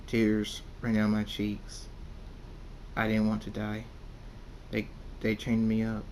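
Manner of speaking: usual speaking voice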